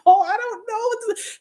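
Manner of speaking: very high, mock-tearful wail; imitated weeping. Retelling her crying reply to the doctor, tearful. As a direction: replay a helpless weepy answer.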